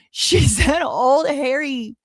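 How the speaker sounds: slow, laughing through the words. Real, not acted. Repeating phrase laughing in disbelief.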